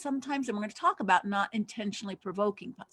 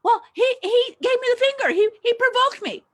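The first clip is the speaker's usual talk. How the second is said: much higher and louder, defensive pleading; whiny excuse; 13 semitones higher, 8 dB louder than usual. Retold or imitated. Angry driver excusing himself to the police in a high whiny voice. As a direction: whine like a guilty driver blaming the other guy. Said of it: "the police come and say".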